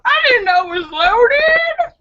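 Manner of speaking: high, wailing mock excuse; slow, high-pitched mock wail; panicked gun owner. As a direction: cry it out in hammed-up panic. Acts careless gun owner.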